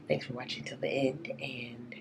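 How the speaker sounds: raspy whisper. Real, not acted. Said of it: "I have no voice".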